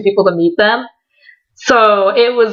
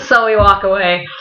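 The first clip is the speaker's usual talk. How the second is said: ordinary delivery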